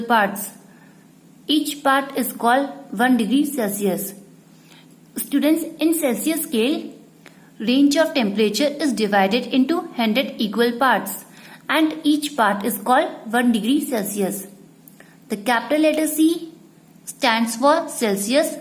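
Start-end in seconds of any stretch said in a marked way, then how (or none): none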